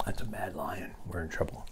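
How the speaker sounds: hushed, whispered warning; whispered aside. Acted. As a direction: whisper it as if trouble is coming.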